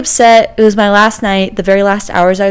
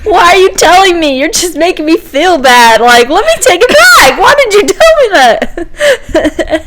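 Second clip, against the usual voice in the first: mock-hurt protest, higher and laughing; laughing exasperation; 9 semitones higher, 4 dB louder than usual. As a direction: whine it through giggles. Retells frustration at family.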